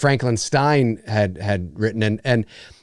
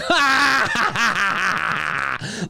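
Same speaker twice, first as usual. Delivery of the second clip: hysterical laughter; 6 semitones higher than usual. Retold or imitated. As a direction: burst out laughing like kids at a joke.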